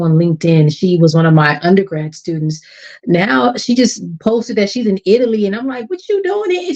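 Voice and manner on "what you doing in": nosy. Retold or imitated.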